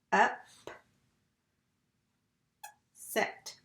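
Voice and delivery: up and set with a long pause between; syllable by syllable. Shown as a demonstration. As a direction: say upset as two separated pieces. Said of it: "When I put it all together".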